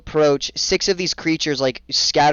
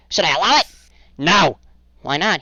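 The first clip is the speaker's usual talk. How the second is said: higher, louder creature chatter; bickering guards; 3 semitones higher, 5 dB louder than usual. Acted. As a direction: squabble among yourselves like little goblins. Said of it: "he goes".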